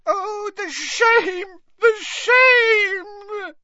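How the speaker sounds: very high, crying elf lament building to a wailing shout; Winky weeps for Mister Crouch, high and crying out; bawling house-elf. Acted. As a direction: sob over her lost master, ending in a wail. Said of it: "and bawled".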